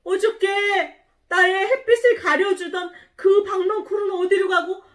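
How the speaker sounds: high, loud bewildered outcry; acted storybook astonishment; shocked surprise. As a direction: exclaim in dismay at a sudden loss. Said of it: "이렇게 유나가 깜짝 놀랐어요".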